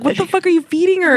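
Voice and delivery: high-pitched startled outburst; shocked high yelp. Retold or imitated. Daughter catching dad dumping food, shocked high cry. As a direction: shriek it in disbelief.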